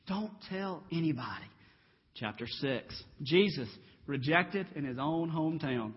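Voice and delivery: ordinary delivery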